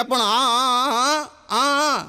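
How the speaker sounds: put-on a boy whose swollen mouth cannot form words; mute boy straining to speak. Boy to his father, strained drawn-out aa sounds.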